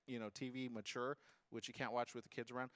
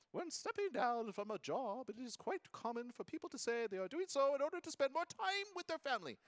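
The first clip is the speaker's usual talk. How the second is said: put-on British accent; 10 semitones higher than usual. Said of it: "it's very British".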